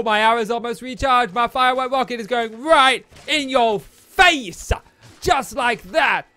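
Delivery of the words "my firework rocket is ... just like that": loud slow gloating taunt. Pretend. Gamer taunting a mob, loud slow gloat. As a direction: gloat as the rocket hits.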